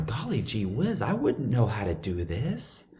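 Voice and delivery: soft, drawn-out naive wonder; golly-gee-whiz innocence. Acted. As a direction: play dumb, slow and gentle, with swooping pitch. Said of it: "my golly gee whiz look".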